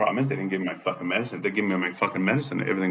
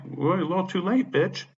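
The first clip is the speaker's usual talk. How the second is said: higher-pitched, scornful comeback; retold angry retort; 11 semitones higher, 3 dB louder than usual. Tenant answering the property manager's offer, indignant. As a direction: throw it back at her, pitched up.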